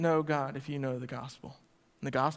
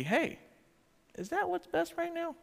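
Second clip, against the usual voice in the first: parent's gentle question; 13 semitones higher, 3 dB quieter than usual. Retold or imitated. Parent voiced questioning a kid.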